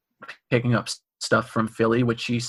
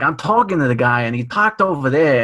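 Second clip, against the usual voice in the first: louder imitated Boston accent, R's dropped; full Boston accent; 3 semitones higher, 5 dB louder than usual. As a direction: imitate an older Boston man's thick accent. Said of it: "he's got the full accent".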